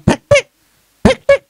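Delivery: high mock shutter clicks; camera click sound. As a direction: mimic a phone camera snapping. Imitating camera clicks for content.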